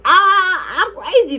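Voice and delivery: high, drawn-out crazy cry; mock crazy outburst. Girl telling the boy who called her crazy, mock-wild. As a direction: play up the craziness, pitched high.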